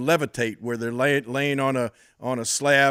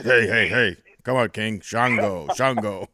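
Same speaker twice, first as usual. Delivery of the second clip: low, gruff boss cutting in; Vince; 5 semitones lower than usual. Acted. Boss correcting the announcer's accent, low and insistent. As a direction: correct him in a lower, forceful voice. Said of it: "Vince would have thought that".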